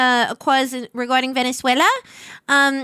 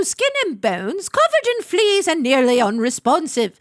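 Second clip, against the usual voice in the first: usual speaking voice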